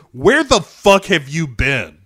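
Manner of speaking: mock furious yell at his missing partner. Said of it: "It's so good, man. He is, he is pissed".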